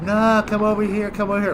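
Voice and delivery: high, insistent mock teammate voice; hammed-up pushy teammate. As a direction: play the pushy newbie. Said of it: "They're like".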